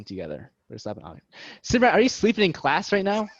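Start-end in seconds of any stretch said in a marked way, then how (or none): 1.6-3.3 raised, louder playful telling-off; high, loud mock scolding; mock-stern teacher. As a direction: pretend to catch a student napping. Pretend teacher scolding, just kidding.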